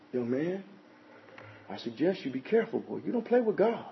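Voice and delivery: stern warning. Retold or imitated. Warning an imagined scoffer.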